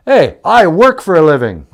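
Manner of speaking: loud, hammed-up protest; mock indignant retort. Son retells answering mother's loan request, mock indignant. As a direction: protest loudly, as in a comic bit.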